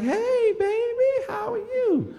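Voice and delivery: very high, drawn-out sing-song greeting; gushing auntie. Retold or imitated. Aunties upstairs greet the boy, high gushing squeal. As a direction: coo it loud and high like a doting auntie.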